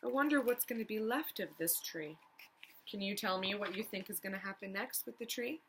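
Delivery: ordinary talk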